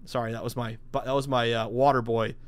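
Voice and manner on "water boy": usual speaking voice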